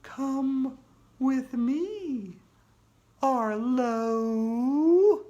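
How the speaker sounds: slow, high, drawn-out ghostly call; scary ghost voice. Acted. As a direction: beckon him eerily and slowly. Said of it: "the scary figure spoke".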